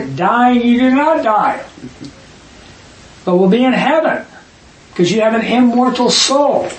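lightly voiced tempter promising heaven; serpent's lie. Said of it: "the serpent say?".

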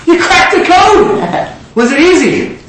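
high, stretched acted cheer; exuberant congratulation. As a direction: crow it out slowly, thrilled for them. Acting Jesus celebrating the man's faith.

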